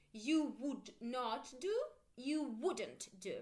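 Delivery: slow "You would not do, you wouldn't do"; slow drill. Shown as a demonstration. Teacher drilling negatives slowly for students. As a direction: lay out both negative forms slowly. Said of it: "Make sure you pronounce with me".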